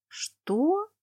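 high stunned repeat; surprised. A narrator's reading. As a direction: squeak it again, bewildered. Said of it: "только и смогла повторить я". (ошеломлённо, высоко)

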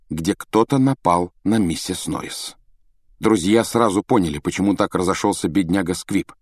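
ordinary delivery, nothing marked

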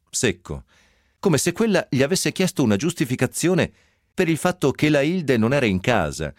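ordinary delivery

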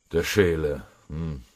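ordinary delivery, nothing marked

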